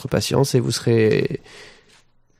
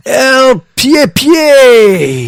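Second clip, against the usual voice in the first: ring announcer; 11 semitones higher, 9 dB louder, much slower than usual. Acted. Boxing-style name call.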